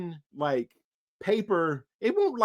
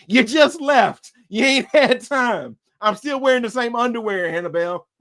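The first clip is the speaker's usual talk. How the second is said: much higher, louder scoffing; incredulous retort; 5 semitones higher, 4 dB louder than usual. Real, not acted. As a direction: scoff at the ghost's note. Retorts to ghost.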